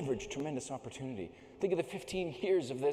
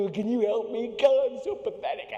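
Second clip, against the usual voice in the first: high loud whiny begging; pathetic pleading; 8 semitones higher, 8 dB louder than usual. Retold or imitated. Desperate climber begging the oilman for help, whiny and loud. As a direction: beg like a pathetic kid. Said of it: "I'm like".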